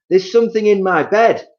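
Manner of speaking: high, loud panicked cry; frightened scream. Acted. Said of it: "screamed Missus Twit".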